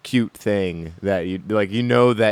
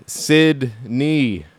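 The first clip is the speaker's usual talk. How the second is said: ordinary talk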